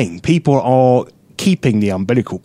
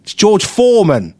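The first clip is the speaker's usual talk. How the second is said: louder, pointed explanation; explaining; 5 semitones higher, 4 dB louder than usual. Retold or imitated. Another guest spelling out the joke, louder. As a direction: spell it out for him. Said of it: "somebody went".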